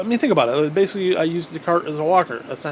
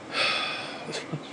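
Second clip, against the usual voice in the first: heavy sigh; 10 dB quieter than usual. Real, not acted. Exhausted sigh.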